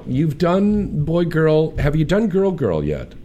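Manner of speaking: ordinary delivery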